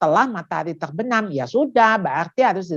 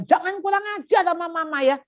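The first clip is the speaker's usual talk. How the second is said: high-pitched mock scolding; acted indignant mum; scolding mother; 9 semitones higher than usual. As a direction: tell off a child in a raised pitch. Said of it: "Jangan dimarahin dong".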